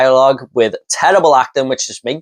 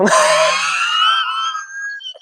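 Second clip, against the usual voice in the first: squealing laughter, much higher; helpless high cackle; high shrieking laugh; 4 dB quieter than usual. Real, not acted. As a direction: laugh in disbelief. Laughing at the snake line.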